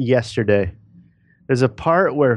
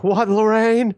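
much higher, put-on voice of a spaced-out husband; George McFly; 10 semitones higher, slower than usual. Movie husband answering his reminiscing wife, lost in space. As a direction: imitate a lost-in-space husband's high-pitched "what". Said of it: "and he's like".

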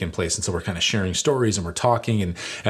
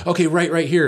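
higher, louder sudden direction; calling out; 8 semitones higher, 4 dB louder than usual. Retold or imitated. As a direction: call the turn at the last second. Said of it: "he goes".

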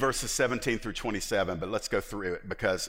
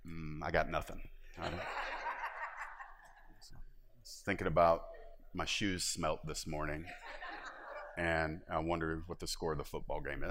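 mumbling husband; 3 semitones lower, 7 dB quieter than usual. Retold or imitated. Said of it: "And he's like".

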